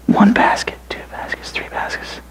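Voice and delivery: barely audible whisper, fading away; whispered counting. Acted. Boy counting miracle baskets in a fading whisper. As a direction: count the baskets in an awed hush.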